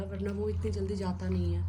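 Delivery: ordinary talk, nothing marked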